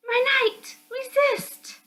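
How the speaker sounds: put-on very high girlish plea; pleading high voice. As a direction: beg your knight to hold on. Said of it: "the voice of Lillian".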